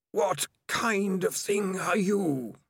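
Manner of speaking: slow, eerie, hissing voice; dark whisper behind you. Acted. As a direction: slide it in like a knife. Hidden voice speaks behind Morris.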